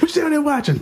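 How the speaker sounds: high mom voice calling downstairs; suspicious mom calling. Retold or imitated. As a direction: call down like a suspicious mother.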